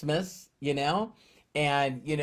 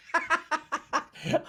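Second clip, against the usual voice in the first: high burst of laughter. Real, not acted. Laughs at own phone mishap.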